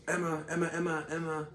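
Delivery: imitating an obnoxious doctor, high and repetitive; high, insistent mock calling; obnoxious name-calling.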